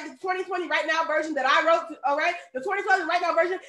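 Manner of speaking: normal talking voice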